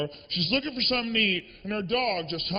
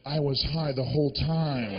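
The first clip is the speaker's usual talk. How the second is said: laid-back animator; 8 semitones lower than usual. Retold or imitated. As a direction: say it like it's obvious, mellow. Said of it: "He's like".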